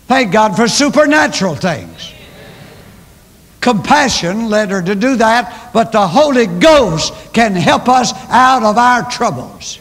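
a rising pulpit call; preaching. Acted. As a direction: preach it out with full force. Preaching at full force.

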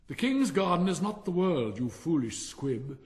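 loud, high scolding candle; booming scornful voice; big Roman candle. A narrator's reading. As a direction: scold the foolish squib. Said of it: "said a big Roman candle".

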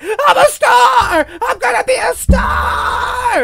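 high, slow, shrieking star shout; Pearl shout. Retold or imitated. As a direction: scream it like a crazed starlet shaking him. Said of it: "as Pearl".

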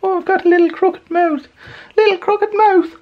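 put-on very high Irish old-lady voice; high mock Irish accent; Irish nana. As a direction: squeak it like a little Irish nana. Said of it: "in a little Irish accent".

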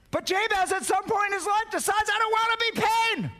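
high, sustained preacher's cry; impassioned high-pitched declaration; defiant outcry. Acted. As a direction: cry it out high like a firm decision. Builds to Jabez's defiant decision.

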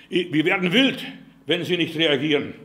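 lauter, aufgebrachter Erpresser; nachgeahmte Drohung. Retold or imitated. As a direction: eine wütende Drohung laut nachmachen. Liest Drohung des Hackers vor. (laut nachgeäffte Drohung)

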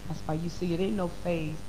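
ordinary talk

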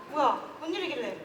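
ordinary talk, nothing marked

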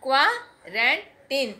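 put-on "क्वारंटीन" very slow, with a "k" start and a long "teen"; drawn-out "क्वारंटीन", "kwaa-ran-teen"; correct pronunciation. Shown as a demonstration. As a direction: model "क्वारंटीन" slowly for the viewers. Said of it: "जब हम बोलेंगे तो के से बोलेंगे".

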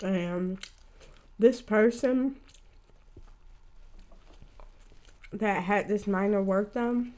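chewing between words; talking while eating. Real, not acted. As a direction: food in mouth. Seen 1: Gloved hands pick at wing near mouth; bites while talking.

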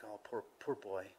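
hushed sympathy, as if to yourself; pitying. Acted. Believer pitying a mean unbeliever, quietly pitying. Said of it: "You can have pity on them".

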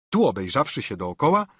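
plain reading voice

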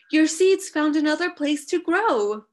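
high, loud storybook excitement; excited announcement. A narrator's reading. Friends telling Badger about his seeds, excited calling. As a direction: burst out with the good news, high and loud.

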